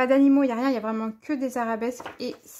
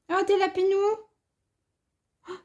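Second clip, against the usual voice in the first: cooing delight; 7 semitones higher than usual. Real, not acted. Crafter spotting little bunny stickers, high delighted coo. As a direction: melt at something adorable.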